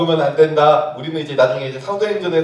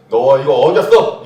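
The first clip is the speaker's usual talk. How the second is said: slow, louder rebuke 너 이거 어겼어; a stern father catching a child; scolding God; 4 semitones lower, 6 dB louder, slower than usual. Acted. Preacher voicing God scolding a sinner, mock stern and slow.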